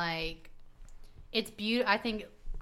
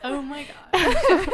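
higher, louder, slow laugh-filled gasp; 7 semitones higher, 7 dB louder, slower than usual. Real, not acted. Host reacting to the awful toast, laughing in disbelief.

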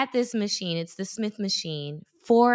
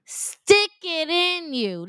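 slow, higher creepy voice; a slow, higher mock creep line; creepy gym guy; 9 semitones higher, slower than usual. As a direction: drawl it out slowly and suggestively. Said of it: "That's what he said".

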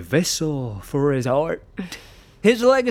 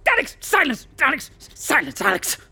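commanding shout; 10 semitones higher, faster than usual. Acted.

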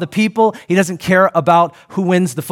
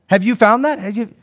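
ordinary talk, nothing marked

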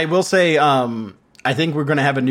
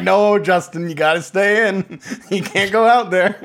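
mock scolding; 5 semitones higher than usual. Family keeping Justin at home, mock scolding. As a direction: play at forbidding a grown man. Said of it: "They're like".

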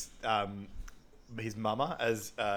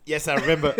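laughing quote; 4 semitones higher, 10 dB louder than usual. Retold or imitated. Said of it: "He goes".

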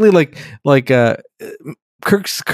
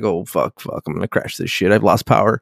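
mock panic; 5 semitones lower, 4 dB quieter than usual. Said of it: "he's panicking".

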